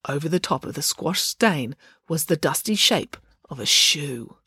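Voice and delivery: ordinary delivery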